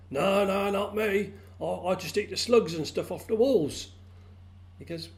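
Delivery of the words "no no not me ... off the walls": higher, lightly voiced frog in denial; well frog protesting. A narrator's reading. Well frog denies having a tongue.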